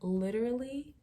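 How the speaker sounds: usual speaking voice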